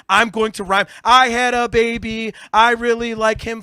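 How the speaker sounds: high, loud mimicry of bad rhymes; mocking childish lyrics. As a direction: recite it with scornful, third-grade simplicity. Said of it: "not fucking third grade".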